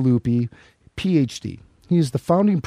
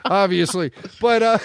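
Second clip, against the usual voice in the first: ordinary talk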